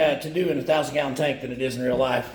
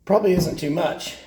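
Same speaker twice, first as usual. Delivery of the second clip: usual speaking voice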